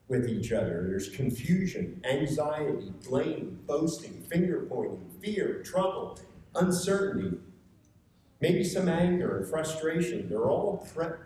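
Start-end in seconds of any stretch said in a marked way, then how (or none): none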